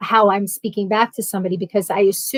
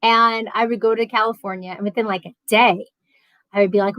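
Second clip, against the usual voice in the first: ordinary talk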